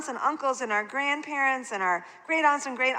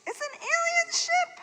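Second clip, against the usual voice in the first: much higher, breathy-free squeaky announce; playful alien announce. Acted. Child pretending in the living room, squeaky high. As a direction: pipe it up like a kid playing spaceman. Child playing space game.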